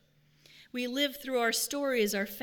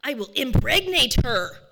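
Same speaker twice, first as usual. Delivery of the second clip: louder, higher mock boast; boastful loud declaration; 4 semitones higher, 11 dB louder than usual. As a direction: crow it out proudly. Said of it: "deep and gravelly voice".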